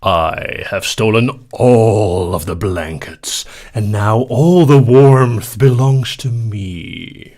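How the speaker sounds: slow, loud theatrical villain menace; evil villain voice. Pretend. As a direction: drag it out like a gloating villain. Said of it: "Could you say this in an evil villain voice?".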